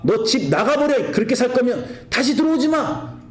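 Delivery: mock angry parent. Parent throwing child out.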